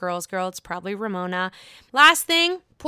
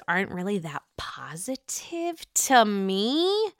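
hushed, whispered dig; whispered aside; slower than usual. Real, not acted. Host slips in a dig about Candace's mother, whispering. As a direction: drop to a whisper for the jab.

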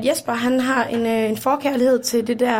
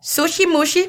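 higher-pitched pretend Japanese recital; mock Japanese; 4 semitones higher than usual. Daughter performing her story for the guests, mock Japanese. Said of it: "Hun tager den på japansk".

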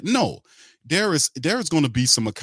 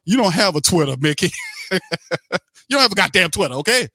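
louder, higher, scolding Mickey; angry scolding; 4 semitones higher, 3 dB louder, faster than usual. Real, not acted. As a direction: call him out, fired up and indignant. Scolding Mickey about Twitter.

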